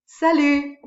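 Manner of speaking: salut, tossed out high and loud, "sa-LU" with no final T; French pronunciation. Shown as a demonstration. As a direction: call salut out cheerfully. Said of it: "that means hi and bye".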